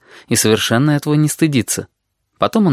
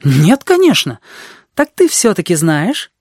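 put-on higher, louder indignant girl; 7 semitones higher, 3 dB louder than usual. A narrator's reading. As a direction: protest with mild indignation. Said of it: "Она возмутилась".

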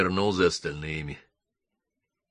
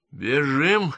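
ordinary delivery

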